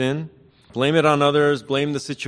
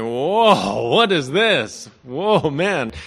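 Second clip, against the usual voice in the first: stunned "whoa", pitched up and drawn out; amazed Adam; 3 semitones higher, 4 dB louder, much slower than usual. Acted. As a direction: play Adam's awestruck wonder. Adam sees the woman.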